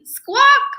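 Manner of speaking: high loud bird squawk; gull squawk. Acted.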